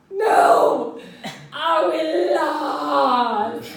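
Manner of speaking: crying. Retold or imitated. Girl wailing to her leader, crying loudly. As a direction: wail it like a sobbing child. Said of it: "And she says".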